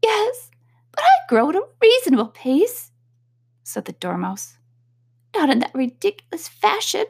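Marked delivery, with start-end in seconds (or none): 0.0-2.9 squeaky Dormouse. A narrator's reading. Dormouse voiced in story. 5.3-7.1 high, huffy little Dormouse voice; sulky Dormouse. A narrator's reading. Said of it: "got up very sulkily".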